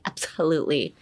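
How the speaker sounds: ABSOLUTELY low and flowing, stress on LU; easy flow. Shown as a demonstration. Teacher showing it flows on your mouth, easy ABSOLUTELY. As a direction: let ABSOLUTELY roll out easily. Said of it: "how easily it should flow".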